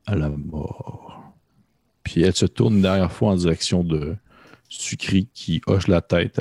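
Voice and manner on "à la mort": slow, soft, breathy death sentence; cavernous whisper. Acted. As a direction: hiss it out slowly, deathly calm. Dao confirms duel to death.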